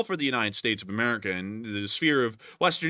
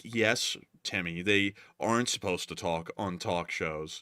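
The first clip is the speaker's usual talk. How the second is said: a hint of a carer humouring a patient, softer and slow; mock soothing caretaker; 5 semitones lower, 5 dB quieter, slower than usual. Carer humouring a talk-show hater, lightly voiced and quiet. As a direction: humour him slowly and quietly.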